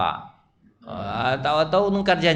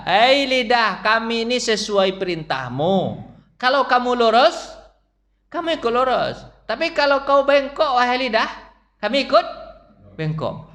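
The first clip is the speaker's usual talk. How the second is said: higher, louder solemn address to the tongue; body talking to the tongue; 8 semitones higher, 3 dB louder than usual. Acted. Preacher voices the body warning the tongue, high and loud. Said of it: "badan ini berkata kepada lidah".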